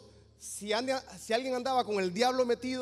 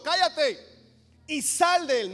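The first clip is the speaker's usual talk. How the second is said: acted command, higher and louder; raised, forceful mock rebuke; indignant rebuke; 7 semitones higher, 5 dB louder, slower than usual. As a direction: order it out with righteous indignation. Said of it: "se indignó espiritualmente".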